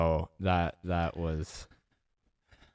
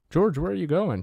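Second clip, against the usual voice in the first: higher, louder call after someone walking off; raised, questioning shout; 11 semitones higher, 7 dB louder than usual. Retold or imitated. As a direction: call after a departing coworker. Coworker calls after departing George.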